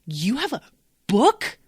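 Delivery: an irritated wizard scoffing at a wizard's manual, incredulous yell; mad outburst. Retold or imitated. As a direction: blast her with angry disbelief. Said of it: "He gets so mad".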